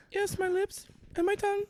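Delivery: high granny voice; granny answering matter-of-factly; foul mouthed granny. Acted. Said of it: "my grandma voice is not good".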